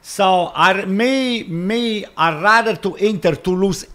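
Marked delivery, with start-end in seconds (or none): none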